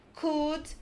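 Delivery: normal talking voice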